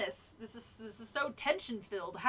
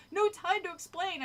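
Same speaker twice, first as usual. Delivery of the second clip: forced out, higher, strained; choked out; 6 semitones higher than usual. A narrator's reading. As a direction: choke the words out. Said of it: "I choked out".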